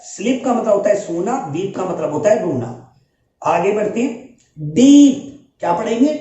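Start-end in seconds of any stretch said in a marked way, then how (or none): 4.6-5.5 loud sharp ee. Shown as a demonstration. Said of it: "sound तेज है".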